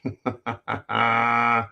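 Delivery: quiet laugh at himself; low amused chuckle; soft chuckle. Real, not acted. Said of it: "Calm down".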